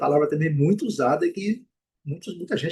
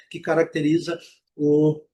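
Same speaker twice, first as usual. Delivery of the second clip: ordinary talk, nothing marked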